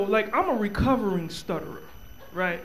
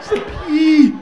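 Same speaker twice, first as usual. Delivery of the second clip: nagging woman; 7 semitones higher, 6 dB louder than usual. Retold or imitated. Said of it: "She keep on going".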